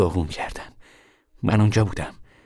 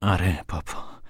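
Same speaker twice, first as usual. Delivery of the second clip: ordinary delivery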